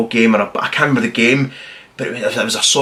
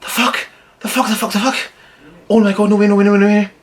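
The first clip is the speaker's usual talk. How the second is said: shocked disbelief; 9 semitones higher, faster than usual. Retold or imitated. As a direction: relive the shock of seeing the captain shot. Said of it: "and you're just like".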